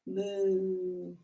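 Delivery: low, soft cow moo; quiet cow noise for the kids. Pretend. Cow pose, kids yoga.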